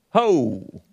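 "Ho" drawn out with a long o; syllable repeated, HOH. Shown as a demonstration. Puzzling over the phonetic spelling, "Ho" with a long o. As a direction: sound out "Ho" to rhyme with go. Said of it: "it's pronounced ho".

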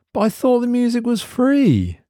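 indignant whine. A narrator's reading. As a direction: whine it out to nobody. Mark complains to nobody.

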